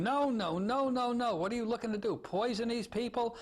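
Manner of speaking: high, agitated doctor's scolding; retold yell, pitched well up; mock yelling.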